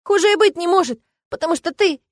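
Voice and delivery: high, loud acted outburst; tearful accusation. A narrator's reading. Woman accuses the dragon, tearful and high. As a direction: throw it at him in upset. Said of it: "рыдая, возразила я".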